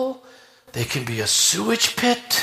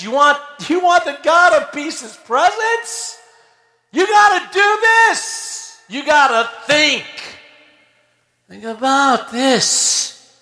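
high, pressing plea as Paul; voicing Paul; 8 semitones higher than usual. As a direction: urge them like the apostle. Preacher voicing Paul's exhortation.

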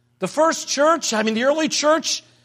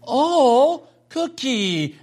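put-on little child's voice; 3 semitones higher, much slower than usual. Grandfather mimicking his grandson eyeing a cookie, little child's voice. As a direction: imitate a little boy cooing over a treat. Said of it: "hear that little voice go".

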